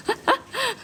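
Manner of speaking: high squealing giggle; laugh. Real, not acted. Streamer jokingly calls a viewer dishonest, then a high giggle.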